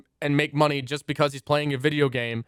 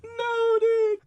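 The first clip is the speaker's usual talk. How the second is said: high squealing laugh. Real, not acted. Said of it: "high squealing laughter-crying".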